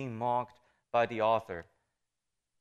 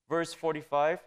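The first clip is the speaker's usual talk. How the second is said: usual speaking voice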